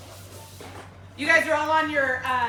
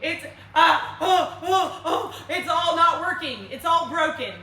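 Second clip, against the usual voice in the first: laughing, higher mock panic over a broken graph; mock panicked client; 4 semitones higher, 5 dB louder than usual. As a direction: play a client half-laughing in fake despair. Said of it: "and they're like".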